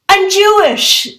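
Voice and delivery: high, loud, slow blurt; blurted confession. Retold or imitated. Little girl blurting to Santa on his lap. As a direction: it bursts out of the kid.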